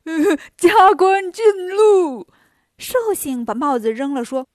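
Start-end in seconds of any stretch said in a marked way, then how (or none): 0.1-2.3 high, loud, drawn-out proclamation; guffawing Bajie. A narrator's reading. Bajie crowning the Longevity Star with his hat, uproariously proclaiming. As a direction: stretch it out as a boisterous joke. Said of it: "拍着手哈哈大笑说".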